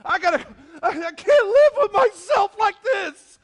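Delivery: high, loud, slow wail of despair; hammed-up anguished shout; anguished mock cry. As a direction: cry it out like a tortured conscience. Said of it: "and they go".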